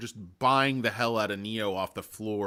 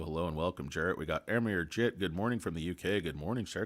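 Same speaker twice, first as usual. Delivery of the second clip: ordinary talk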